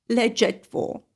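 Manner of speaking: ordinary talk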